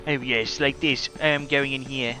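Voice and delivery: put-on British accent sliding toward Australian; bad British accent. Shown as a demonstration. Gamer showing the accent they keep doing, bad British accent. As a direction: attempt British, land somewhere near Australian. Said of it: "That sounded sort of Australian".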